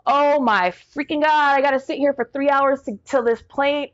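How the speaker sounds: high, exasperated panic; panicked. Retold or imitated. Wife stranded in Chicago airport, high panicked exasperation.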